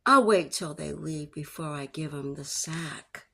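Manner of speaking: plain reading voice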